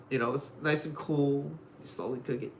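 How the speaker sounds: normal talking voice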